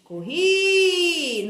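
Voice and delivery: high, loud "Corri" with RI held long; stretched last syllable of "Corri", sustained; calling out. Shown as a demonstration. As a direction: shout the word as if calling someone. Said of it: "como se eu tivesse chamando".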